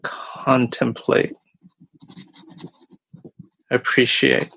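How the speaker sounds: slow, drawn out, word by word; slow dictation. Real, not acted. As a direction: say each word slowly as you write it down. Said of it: "let me write that down".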